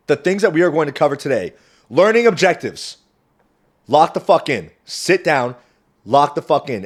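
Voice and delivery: rally the audience to pay attention; hype-up call. Real, not acted. Vlogger ordering viewers to focus, loud and pumped.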